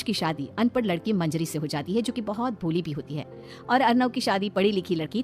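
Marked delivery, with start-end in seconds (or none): none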